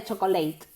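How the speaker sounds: "chocolate" wrongly as cho-co-LATE, rhyming with eight; three-syllable mispronunciation. Shown as a demonstration. Teacher warns students off this, three-syllable cho-co-late. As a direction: give "chocolate" three syllables ending in "leit". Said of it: "Nada de chocolate".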